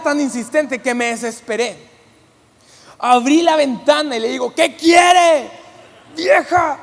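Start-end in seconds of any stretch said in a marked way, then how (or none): 4.5-6.8 annoyed retort. Retold or imitated. Pastor finally snaps at her.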